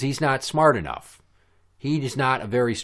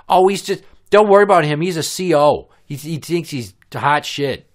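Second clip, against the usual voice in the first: loud, higher put-on voice; hammed-up belittling imitation; mock dismissive neighbor; 6 semitones higher, 9 dB louder than usual. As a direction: wave him off scornfully in her voice. Mimicking her dismissing him.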